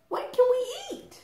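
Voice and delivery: very high tiny chick voice; little chick. Acted. First little chick asking Mother Hen for food, tiny high voice. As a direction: squeak it like a baby chick. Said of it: "said the second little chick".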